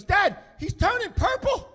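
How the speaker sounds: high, loud, drawn-out cry of alarm; slow, high-pitched mock panic; alarmed kid. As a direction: mimic a panicked son sounding the alarm. Alarmed son during dad's choking.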